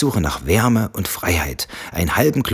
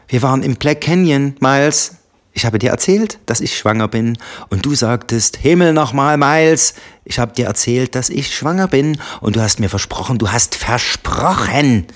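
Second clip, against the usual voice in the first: high and loud, slowing on the last promise; angry insistence; 7 semitones higher, 4 dB louder than usual. Acted. Wife furiously reminding husband of his promise, heated. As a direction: hammer home his broken promise.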